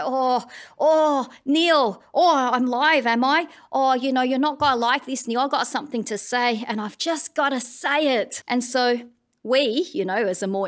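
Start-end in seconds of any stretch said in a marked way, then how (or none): 0.0-8.4 flustered first-time caller, higher and louder; excited first-time caller blurting at the host; talkback caller. Retold or imitated. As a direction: splutter it out like a nervous caller finally on air.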